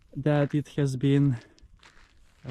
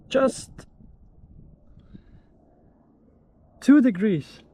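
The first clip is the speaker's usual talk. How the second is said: surprised; 8 semitones higher, 6 dB louder than usual. Real, not acted. Reads surprising water temperature.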